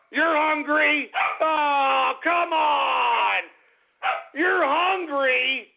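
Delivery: high, drawn-out incredulous cry; slow, strained disbelief; exasperated wailing. Real, not acted. Exasperated at hungry Templeton.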